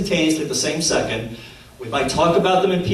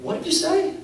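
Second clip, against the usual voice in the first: incredulous question; 12 semitones higher than usual. Retold or imitated. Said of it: "I look at him and I said".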